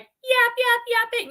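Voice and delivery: high, loud mock yapping; family yapping. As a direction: imitate a chattering Boston family. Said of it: "we're all like".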